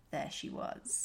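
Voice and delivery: breathy sultry whisper. Acted. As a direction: breathe it out seductively. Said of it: "sexily read this out".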